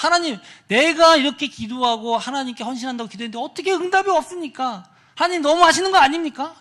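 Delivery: high, loud indignant complaint; acted angry protest. Disappointed youth complains to God, acted anger. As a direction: protest upward as if badly let down. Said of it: "너무 화가 나서".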